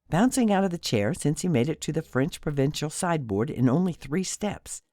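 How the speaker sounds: usual speaking voice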